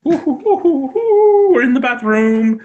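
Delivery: high loud hooting ooh; excited high ooh sounds to the dog. Real, not acted. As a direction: hype the dog up with high hoots. Hyping dog in bathroom.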